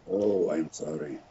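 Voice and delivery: lightly put-on Polish accent, playing the old pianist; mock Polish accent. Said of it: "putting on what in my mind was [name]'s Polish accent".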